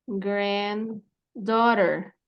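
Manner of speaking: granddaughter stretched very slowly, gren-doh-der; slow pronunciation model. Shown as a demonstration. Teacher models granddaughter for the student, very slowly. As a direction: draw granddaughter out syllable by syllable. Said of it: "Esa es la pronunciación".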